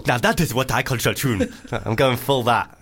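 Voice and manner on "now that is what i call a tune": put-on South African accent. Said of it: "that was a very good accent".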